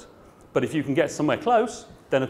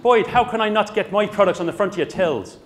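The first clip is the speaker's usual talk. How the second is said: put-on Irish accent, higher and louder; [name], Irish accent; 7 semitones higher, 5 dB louder than usual. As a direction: imitate an indignant manufacturer in an Irish accent. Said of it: "who said in his Irish accent".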